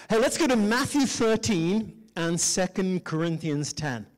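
usual speaking voice

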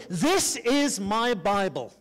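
slow, spaced-out "This is my Bible"; leading a declaration. Shown as a demonstration. Preacher leads congregation in saying it aloud, slow declaration of "This is my Bible". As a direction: say "This is my Bible" slowly, for a crowd to repeat. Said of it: "say this out loud with me".

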